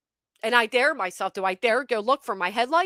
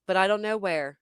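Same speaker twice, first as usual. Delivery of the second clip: normal talking voice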